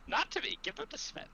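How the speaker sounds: very high, airy character voice; high, dainty little instruction; Fanny. Acted. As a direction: correct them sweetly in falsetto. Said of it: "Fanny will go over to V and will say".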